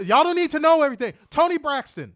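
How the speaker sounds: high, loud and fast exclamation; loud outburst. Real, not acted. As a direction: blurt it out, cutting yourself off. Bursting out loud.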